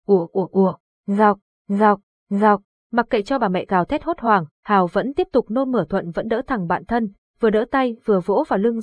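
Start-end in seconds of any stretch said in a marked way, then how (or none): 0.1-2.6 louder gagging heaves; retching and gurgling. A narrator's reading. Narrator voices the boy still vomiting while his mother wails, loud retching. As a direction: retch it out loudly.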